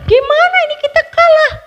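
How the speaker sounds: high, fast, loud lament; wailing crowd. A narrator's reading. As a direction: wail in distress at defeat. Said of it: "Pada nangis". (rakyat meratap, tinggi dan cepat)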